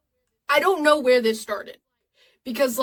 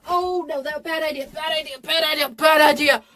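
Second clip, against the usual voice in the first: mock panic; 4 semitones higher than usual. Acted panic building.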